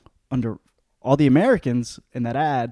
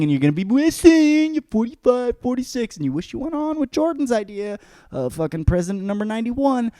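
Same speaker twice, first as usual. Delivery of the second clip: mock rant; 8 semitones higher, 7 dB louder than usual. Ranting at listeners.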